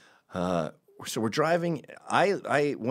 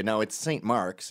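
usual speaking voice

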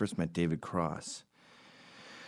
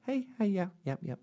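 much higher, airy quick nods of agreement; breezy brush-off; 12 semitones higher than usual. Shown as a demonstration. Celebrity waves off a sarcastic fan, high and breezy. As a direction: brush them off cheerfully, keep walking. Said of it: "just go".

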